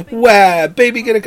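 high, loud fake wail then babyish taunt; mock-cry like a four-year-old; imitating a whiny toddler. Dad imitating his son's fake crying, high and loud. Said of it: "he'll just go".

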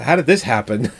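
normal talking voice

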